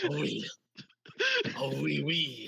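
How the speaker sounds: playful Quebecois "Oh, oui" with a laugh in it; mock French Canadian. GM teases the player's accent, mock French Canadian. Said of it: "Yeah, Canadian".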